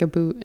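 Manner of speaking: "a boot" with an "oo" vowel, the Canadian way of saying "about". Shown as a demonstration. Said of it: "about would be".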